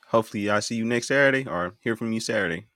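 ordinary talk

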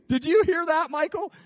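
loud, high amused call through laughter; God laughing to Michael at a lie. Pretend. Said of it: "What does God do when he hears a lie".

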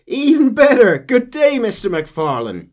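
high mocking bandit greeting; taunting bandit. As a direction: greet him with mock cheer.

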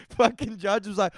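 ordinary talk, nothing marked

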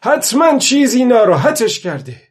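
high, loud exclamation; aloud, loudly. A narrator's reading. Old man alone, something has upset the fish, loud exclamation. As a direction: call it out well above the narration. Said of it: "پیرمرد با صدای بلند گفت".

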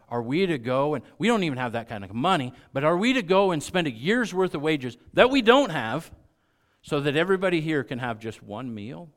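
incredulous sarcasm. Retold or imitated. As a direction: build into loud disbelieving sarcasm. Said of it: "their sarcasm".

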